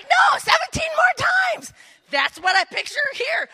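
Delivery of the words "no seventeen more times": put-on loud, very high child's squeal; demanding kid. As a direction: beg like an overexcited little kid. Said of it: "They're like".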